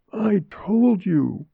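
high, slow, drawn-out gasp; gasped dying words. A narrator's reading. Collapsed girl to her mother, gasping. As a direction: gasp it slowly in a high girl's voice. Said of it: "Mary gasped".

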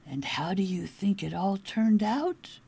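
ordinary delivery, nothing marked

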